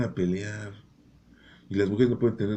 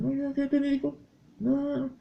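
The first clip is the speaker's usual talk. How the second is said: very high squeaky mocking woman voice. Preacher imitates women who want children, very high. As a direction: squeak it like a fussy lady. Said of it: "hay mujeres que dicen".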